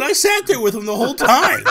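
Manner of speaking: high, loud and laughing disbelief; laughing mock disbelief; laughing retort. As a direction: laugh through it at the wife. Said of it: "I said".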